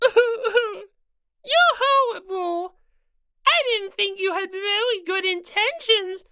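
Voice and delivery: high-pitched, sobbing through the words; tearful rabbit mascot. Acted. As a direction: wail it out, hurt and crying. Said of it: "breaking down into tears".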